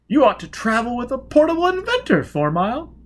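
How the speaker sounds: high and loud eager society voice; breathless with excitement to meet him; gushing young lady. Acted. Lady Elizabeth meeting Four Mile, gushing high.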